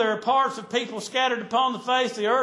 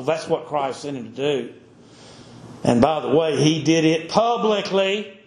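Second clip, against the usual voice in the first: low, weighty preaching read; solemn pulpit reading of scripture; 7 semitones lower than usual. Acted. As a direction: intone the verses like a preacher. Reads scripture in sermon.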